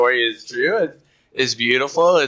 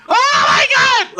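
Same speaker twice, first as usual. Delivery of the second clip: high, loud hammed-up excited yell; mock a fan losing it with excitement; freaking out; 7 dB louder, slower than usual. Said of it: "he was freaking out".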